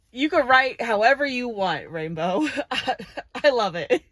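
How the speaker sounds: loud, amused, laughing between phrases. Real, not acted. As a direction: tease fondly through real laughter. Laughing while teasing the author.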